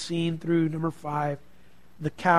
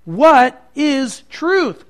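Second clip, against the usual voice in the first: loud, very slow, higher-pitched rhetorical question; question meant as statement; 6 semitones higher, 10 dB louder, much slower than usual. Acted. Preacher quotes Pilate to Jesus, a question meant as a statement. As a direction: weigh each word as a loud challenge. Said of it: "he said it as a question, but do you remember he meant it more as a statement".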